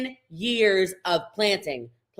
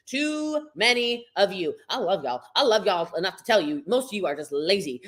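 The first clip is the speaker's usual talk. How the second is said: ordinary delivery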